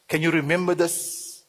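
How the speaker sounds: ordinary talk, nothing marked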